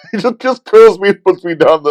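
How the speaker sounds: high, loud, amused disbelief; laughing as the words come out; laughing retelling. Real, not acted. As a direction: tell it through a laugh, voice jumping up. Laughing as he retells being lifted.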